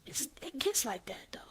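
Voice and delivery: whispered aside. Real, not acted. Whispering a side comment to the host.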